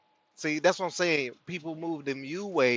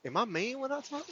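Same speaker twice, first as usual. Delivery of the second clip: much higher, softer, timid question; asking my spirit; 11 semitones higher, 6 dB quieter than usual. Retold or imitated. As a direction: ask the spirit meekly, in a small high voice. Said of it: "I have to ask my spirit".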